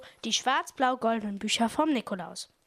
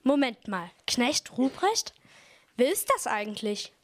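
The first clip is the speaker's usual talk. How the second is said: normal talking voice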